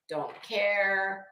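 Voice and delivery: normal talking voice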